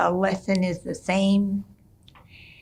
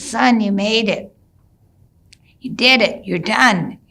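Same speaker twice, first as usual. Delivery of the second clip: louder, joyful call to the son; proud father calling; 3 semitones higher, 6 dB louder than usual. Retold or imitated. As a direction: announce it like a proud dad.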